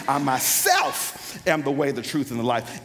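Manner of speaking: "myself" pushed up high, then settle down; stress on MYSELF. Shown as a demonstration. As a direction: punch "myself" with a leap in pitch. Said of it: "had that high pitch".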